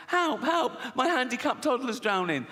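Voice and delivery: high-pitched frantic mother; mock panic. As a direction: cry for help in a high voice. Woman calls for help on beach.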